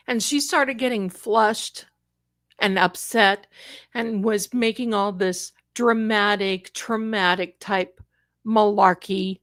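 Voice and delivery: ordinary delivery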